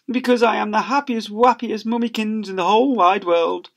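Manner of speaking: gushing mother. Acted. Mother gushing to her son, high sugary voice. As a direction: gush through happy tears.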